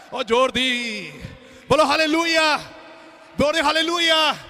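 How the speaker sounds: high, impassioned sermon rising to a shout; full-force preaching. Real, not acted. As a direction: drive each phrase harder towards a roared call. (ਜੋਸ਼ ਨਾਲ ਉੱਚੀ ਆਵਾਜ਼ ਵਿੱਚ ਪ੍ਰਚਾਰ)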